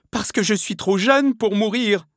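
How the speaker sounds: high, loud wail of protest; acted struggling outcry; desperate cry. A narrator's reading. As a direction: plead for your life at the top of your range. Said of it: "s'écria Milady en se débattant".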